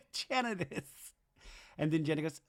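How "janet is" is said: slow, high, half-laughed repeat; laughing through it. Real, not acted. Repeating Zach's blame through laughter. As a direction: savor the line while still laughing.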